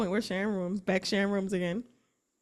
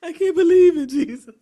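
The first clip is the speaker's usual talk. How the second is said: much higher, louder, laughing exclamation; laughing disbelief; 8 semitones higher, 11 dB louder than usual. Real, not acted. Hearing about the fall, she laughs loudly. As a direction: cackle the disbelief out.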